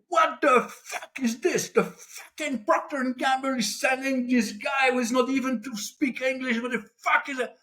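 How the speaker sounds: irate executive. Acted. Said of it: "he looked at me like that, and he said".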